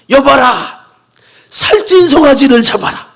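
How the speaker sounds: high, loud proclamation to servants; father commanding a feast for his son. Acted. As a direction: call out the order with joy.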